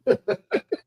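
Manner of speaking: high-pitched burst of chuckles; amused laugh. Real, not acted. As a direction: crack up laughing briefly. Amused by the fish market remark.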